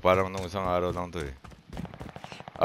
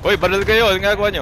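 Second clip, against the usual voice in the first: shouting; 11 semitones higher, 7 dB louder, faster than usual. Real, not acted. Yelling at teammates mid-fight.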